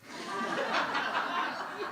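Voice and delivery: a high, airy burst of laughter; breathy high laugh. Real, not acted. Laughing at his own Brooklyn rationalizing, high and airy.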